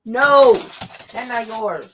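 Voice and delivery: long, loud, high falling no; scolding. Real, not acted. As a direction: draw out a firm reproach to the dog. Scolding dogs.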